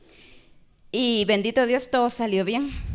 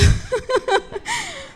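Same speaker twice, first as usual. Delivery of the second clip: real high burst of laughter. Real, not acted. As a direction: laughs at the memory of his silence. Laughs at husband's silence. (risa real y aguda)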